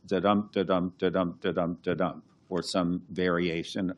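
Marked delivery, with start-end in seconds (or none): 0.1-2.2 sing-song iambic da-dum, stress on every second beat. Shown as a demonstration. Translator showing the audience iambic pentameter, da-dum beat. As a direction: tap out da-dum, da-dum with the stress on each dum. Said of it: "a ten syllable line that goes".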